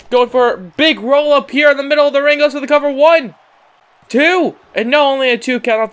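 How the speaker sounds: high, loud near-fall call; excited count. Acted. Commentator calling a roll up and cover, excited. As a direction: punch up the count as the pin nearly lands.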